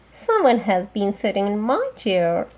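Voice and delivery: ordinary delivery, nothing marked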